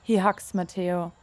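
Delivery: ordinary delivery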